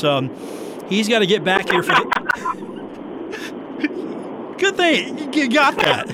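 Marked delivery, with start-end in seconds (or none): 2.1-4.5 high breathless laugh; burst of laughter. Real, not acted. Host cracking up at the guest's phrase. 4.6-6.1 laughing. Real, not acted. Host still laughing at the guest's joke.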